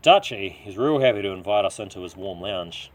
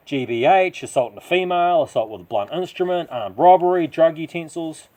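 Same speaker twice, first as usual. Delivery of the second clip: defiant rant; 8 semitones higher, 8 dB louder than usual. A narrator's reading. Listing his criminal convictions.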